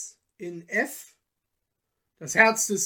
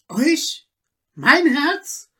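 higher, louder "Mein Herz?" in shock; horrified cry; 8 semitones higher, 8 dB louder, slower than usual. Acted. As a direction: gasp out "Mein Herz?" in terror. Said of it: "schrie Peter mit Entsetzen".

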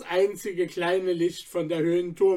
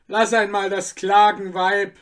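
louder rough shout at the wife; drunk cobbler shouting; 6 dB louder than usual. Acted. The cobbler Anton shouts at his wailing wife, a loud gruff shout. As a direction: bellow it across the room. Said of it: "schrie in der Stube der Schuhflicker Anton".

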